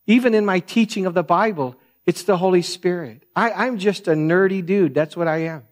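normal talking voice

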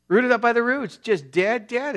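high, loud, fervent sermon delivery; impassioned preaching. Acted. Preacher thundering at the congregation about dead trees, fervent. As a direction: thunder it from the pulpit, drawn out.

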